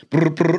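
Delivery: trilled mock ringtone; phone ring imitation. Colleague's phone rings on his break, imitated ringing. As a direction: roll out two short telephone brrs. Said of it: "his phone rings".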